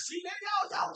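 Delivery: exasperated outburst. Real, not acted. Outburst at fans.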